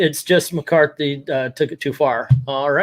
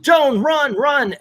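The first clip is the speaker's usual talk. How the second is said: high-pitched urgent cry, no real volume; mock yell; 10 semitones higher, slower than usual. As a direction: imitate yelling a warning, voice pitched way up. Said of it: "our man's yelling".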